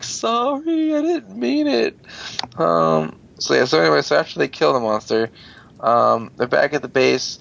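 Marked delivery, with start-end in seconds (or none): 0.0-2.0 put-on high, small apologetic girl voice; squeaky girl apology. Amy squeaks an apology to her angry teammates, high and sheepish.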